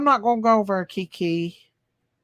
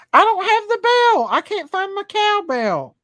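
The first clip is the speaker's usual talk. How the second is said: high, loud playful protest; mock wail; 9 semitones higher, 4 dB louder than usual. Real, not acted. Streamer telling viewers the cowbell is missing, mock wail. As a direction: cry it out much higher than usual.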